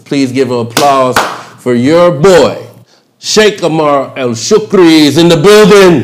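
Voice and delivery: loud, rising crowd-hype intro; hype announcement. Acted. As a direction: pump up the crowd for the guest. Hyping up the guest.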